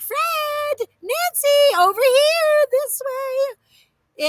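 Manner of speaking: high, ringing calls; calling out. A narrator's reading. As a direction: wave them over from afar. Calling to distant ducks.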